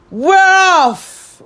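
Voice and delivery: high, loud cheering shout; whole crew shouting with joy. Acted. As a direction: shout it out all together. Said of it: "we all shouted".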